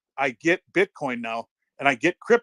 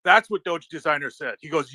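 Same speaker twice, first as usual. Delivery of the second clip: normal talking voice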